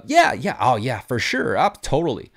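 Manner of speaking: put-on high, bubbly valley girl agreement; gushing valley girl voice. Shown as a demonstration. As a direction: do an over-the-top valley girl, pitch jumping up. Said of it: "I'll sound like a valley girl".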